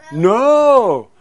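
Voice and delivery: high, loud, drawn-out "No!"; emphatic no. Real, not acted. Preacher tells children the trap failed, emphatic no. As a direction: let the disappointment ring out theatrically.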